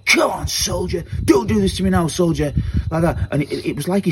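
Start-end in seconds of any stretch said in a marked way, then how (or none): 0.1-2.5 re-enacted shout, pitched up but not full volume; retold shout. Retelling how he roused trapped friend, raised-pitch shout. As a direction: call it out like rousing a soldier. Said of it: "I just shouted".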